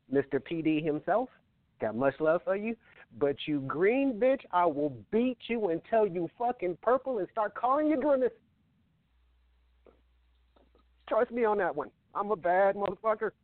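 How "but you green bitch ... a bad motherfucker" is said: wrestling promo. Pretend. Challenge to rival.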